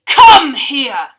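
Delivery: giant's roar, shouted out loud. Acted. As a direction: bellow it like an angry giant. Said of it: "he roared".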